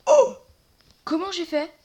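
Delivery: loud, high disbelieving shout; yelling in sheer disbelief at what just happened; real astonished cry; stunned surprise. Real, not acted. Shock at sudden win in fight.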